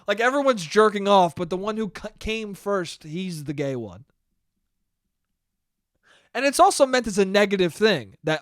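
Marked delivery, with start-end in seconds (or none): none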